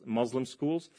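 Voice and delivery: usual speaking voice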